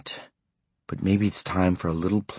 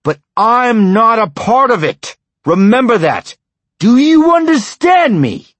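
furious tirade; 12 semitones higher, 8 dB louder than usual. A narrator's reading. Husband laying down the law to his wife, furious shouting. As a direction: hammer each line at her in rage.

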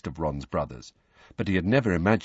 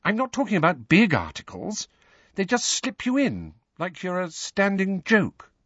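put-on high-pitched girl's voice, explaining; earnest Hermione; 12 semitones higher than usual. A narrator's reading. Hermione explains to Harry how he is mocked, earnestly.

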